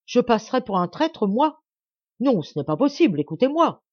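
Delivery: indignant outburst, rising in pitch; wounded indignation. A narrator's reading. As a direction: protest your honour and demand a hearing. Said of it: "La douleur et l'indignation qu'il en ressentit".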